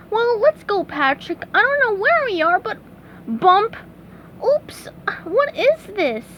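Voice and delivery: high-pitched cartoon hero; bright-eyed cartoon character setting off; high voice, slowing into puzzlement; upbeat SpongeBob. A narrator's reading.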